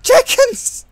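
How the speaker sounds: very high, loud wail of loss; mock anguished scream. Player loses Jenkins to the floor, melodramatic scream. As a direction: scream the name in hammed-up grief.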